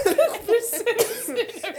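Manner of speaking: big burst of high laughter; cackling laugh; loud laugh. Real, not acted. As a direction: crack up loudly. Laughing at smell joke.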